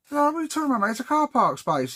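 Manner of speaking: high, outraged mock comeback. Debs' retort in the quarrel.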